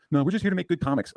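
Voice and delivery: high-pitched mock denial; mocking imitation. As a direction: parody their innocent excuse in a high voice. Mimics people denying their affiliation.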